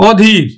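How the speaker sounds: অধীর said "aw-dhir", open first vowel; crisp teacher's "aw-dhir" for অধীর; open O sound. Shown as a demonstration. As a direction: start অধীর on "aw" as in "law". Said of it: "অধীরে উচ্চারণ হবে".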